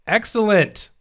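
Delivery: high, loud, delighted "excellent"; excited announcement. Real, not acted. Reviewer revealing a top enthusiasm score to colleague, excited. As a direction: announce a top mark with a big lift.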